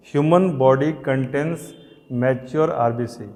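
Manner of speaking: very slow, every word spaced out for note-taking; slow dictation. Shown as a demonstration. Teacher dictating a definition to students, slowly. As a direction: spell it out so students can write.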